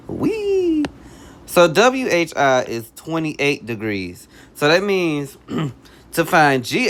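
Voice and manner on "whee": playful whee. Real, not acted. Playful cheer while switching highlighters.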